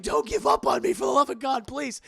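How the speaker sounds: very high, loud desperate begging; desperate plea. Retold or imitated. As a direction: beg him frantically not to give up.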